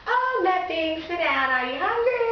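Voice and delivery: high, sweet and seductive; high flirty hostess voice, playing innocent. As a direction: coo it to lure him in. Seducing him, acted quote.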